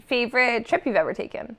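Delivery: usual speaking voice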